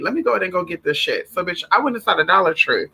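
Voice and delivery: ordinary talk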